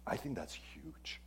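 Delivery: a soft, confiding whisper; whispered aside. Real, not acted. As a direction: whisper it as a secret.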